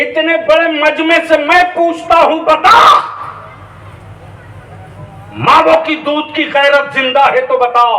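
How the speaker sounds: preacher demanding an answer from the crowd; fiery preaching. Acted. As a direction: call out the gathering with passion.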